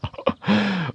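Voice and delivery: short, soft high chuckle; brief amused villain laugh; fox's laugh. A narrator's reading. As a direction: chuckle slyly to yourself. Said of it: "The fox laughed".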